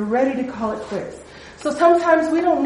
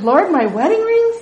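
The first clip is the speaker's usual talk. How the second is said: mildly alarmed, higher-pitched question; nervous question; 7 semitones higher, 3 dB louder than usual. Retold or imitated. Teller retelling her plea to God over her wedding rings, mildly nervous. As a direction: question the Lord, voice rising in mild disbelief. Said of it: "I'm starting to get nervous".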